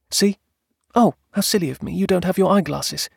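high-voiced wife catching herself; apologetic wife. A narrator's reading. Wife forgot husband's eyeglasses, apologetic. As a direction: apologize lightly in a high wife's voice. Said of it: "she apologized".

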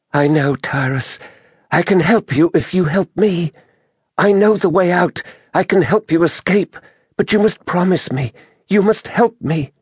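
high-pitched woman's voice, pleading; Merinda. A narrator's reading. Injured druid begs Tyrus to help her escape, pleading. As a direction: bargain urgently in a high, weakened voice.

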